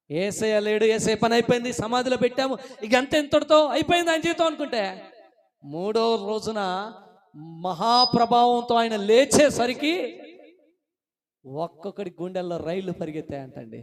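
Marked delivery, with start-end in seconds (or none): 0.1-10.2 loud, high, climbing declamation; full-force preaching. Real, not acted. Preacher tells of Jesus rising from the tomb, full-force preaching. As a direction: build excitedly towards the resurrection.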